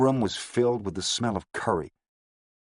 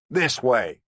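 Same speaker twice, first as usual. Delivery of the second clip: commanding; 5 semitones higher, 4 dB louder than usual. A narrator's reading. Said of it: "he commanded".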